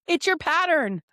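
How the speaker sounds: animated, acted-out comeback; emphatic retold retort. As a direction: toss it back as the obvious answer. Retold exclamation to a client.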